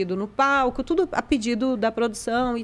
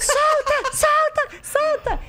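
urgent cry; much slower than usual. Retold or imitated. Crew yelling to let him go.

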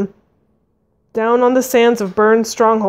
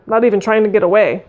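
usual speaking voice

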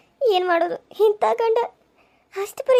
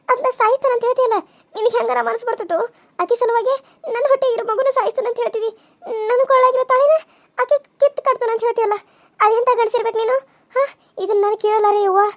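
higher, crying protest; tearful pleading; 4 semitones higher than usual. Acted. Wife crying at husband who threatens her baby. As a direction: sob out the accusation at him.

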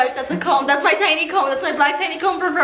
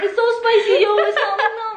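laughing; 7 semitones higher, faster than usual. Real, not acted. Laughing while reacting to spice.

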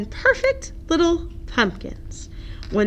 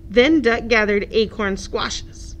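ordinary delivery, nothing marked

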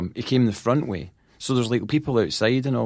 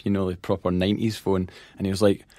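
ordinary talk, nothing marked

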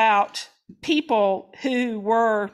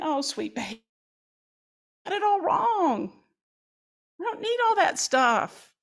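soft, tender, higher-pitched comfort; tender mother; 5 semitones higher, 5 dB quieter than usual. Acted. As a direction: hold the child close and reassure. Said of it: "feel the mother embracing the son".

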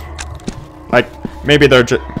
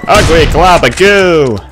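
drawn-out, louder mock jeer, higher; mock taunt; 8 semitones higher, 9 dB louder than usual. Player slowly jeering at a slime monster in a mock voice. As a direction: stretch it out slowly, teasing the monster.

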